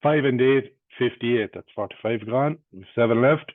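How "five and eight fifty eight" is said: loud punchy bingo call, number then total; bingo caller. Acted.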